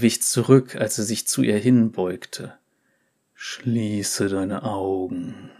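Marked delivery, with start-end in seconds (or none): 3.3-5.5 slow, soft hypnotist voice; hypnotic voice. Acted. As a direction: lull her into trance. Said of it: "mit hypnotischer Stimme".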